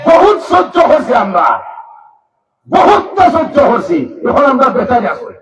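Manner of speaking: high, loud sermon shout; fiery waz preacher rallying listeners; preaching at full force. Acted. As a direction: rouse the crowd at full voice.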